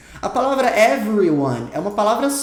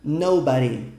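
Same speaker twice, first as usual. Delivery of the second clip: Nobody with NO stronger than body; stress on NO; 5 semitones lower, slower than usual. Shown as a demonstration. Teacher showing the common stress of Nobody. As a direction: hit the first syllable of Nobody. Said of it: "a maioria pronuncia com o no mais forte".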